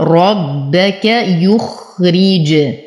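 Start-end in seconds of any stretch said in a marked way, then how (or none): 0.0-1.0 ربك with heavy ر and held double ب; slow ربك, press and hold the ب; doubled bāʾ, heavy rāʾ. Shown as a demonstration. Teacher showing shadda, heavy ر and doubled ب in ربك. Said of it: "أشدد الباء مع تفخيم الراء".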